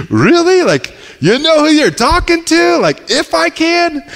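high, playful disbelief; incredulous Jesus. Retold or imitated. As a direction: tease the doubter from way up high. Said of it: "Jesus' response is like".

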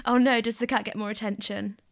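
ordinary delivery

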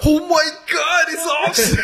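high, loud, drawn-out pretend fan shout; mock fan frenzy. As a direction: play a starstruck fan spotting a celebrity. Acting out a crowd spotting celebrity.

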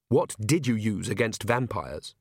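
ordinary delivery

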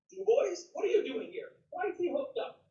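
high, startled question from a superior; surprised officer. Retold or imitated. Lieutenant colonel sees the accused at the lie detector, surprised. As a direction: sound caught off guard finding him here. Colonel finds him at polygraph.

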